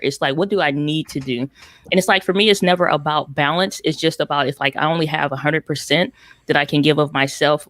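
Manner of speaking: ordinary talk, nothing marked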